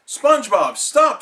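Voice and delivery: loud high shout. Acted. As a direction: shout to stop him. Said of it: "shouted Mister Krabs".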